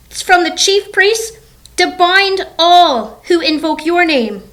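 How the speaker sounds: high, urgent mock-fearful plea; scared protest. Ananias objects to the Lord about Saul, acted scared protest. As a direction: plead upward, lightly acting the fear. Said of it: "So he was scared, right?".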